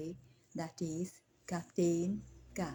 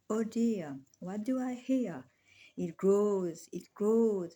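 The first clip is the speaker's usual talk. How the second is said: raised, swelling recitation; dramatic alarm; 3 semitones higher, 5 dB louder than usual. Acted. Insomniac dreamer hears a sound grow, dramatic alarm. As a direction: let the startled question build louder on each repeat.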